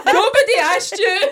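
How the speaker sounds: high, loud, laughing repeat; helpless high-pitched laughter through the words; laughing hard. Real, not acted. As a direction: crack up while repeating the comeback. Repeating the comeback amid laughter.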